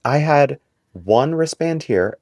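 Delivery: ordinary delivery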